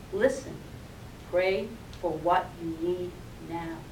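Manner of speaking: low, soft, calm command; soft inner voice. Acted. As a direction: hush her panic with it gently. Inner voice repeats gently.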